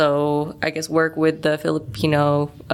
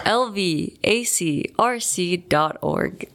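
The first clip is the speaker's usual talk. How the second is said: laughing; 4 semitones higher, slower than usual. Real, not acted. Giving website while laughing.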